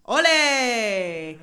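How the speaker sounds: loud festive Ole cheer; Ole shout. Acted. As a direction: belt it like a bullfight crowd. Calls out to the Spanish person.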